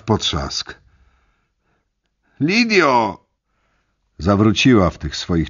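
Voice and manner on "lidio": calling out. Acted. Trapped in barbed wire, calls her.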